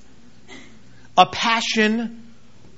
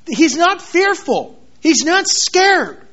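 slow, weighty and raised; preacher driving home Paul's courage, slow; emphatic slow preaching; 7 semitones higher, 4 dB louder, slower than usual. Real, not acted. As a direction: press each word home slowly.

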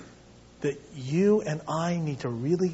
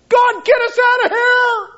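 high, loud, desperate shout; frantic cry; 11 dB louder than usual. Pretend. Preacher plays himself in the dungeon, frantic shout. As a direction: yell for rescue, hammed up.

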